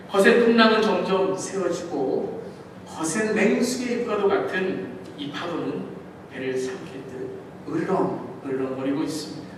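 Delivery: normal talking voice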